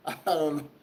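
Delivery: ordinary talk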